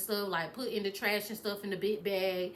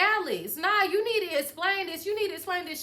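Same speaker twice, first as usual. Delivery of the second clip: insistent demand; 7 semitones higher than usual. Retold or imitated. Retold demand for an explanation.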